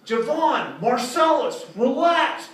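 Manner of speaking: high, slow, calm church lady voice. Retold or imitated. Church lady calmly quiets her demanding kids. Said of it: "she just calmly turns".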